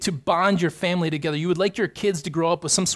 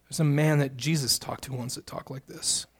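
ordinary talk

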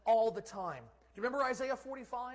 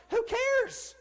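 very high, loud outburst; impassioned pulpit yell; dismissive shout; 9 dB louder than usual. Real, not acted. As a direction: explode with it, brushing the worry away. Preacher's climactic dismissal of election worry.

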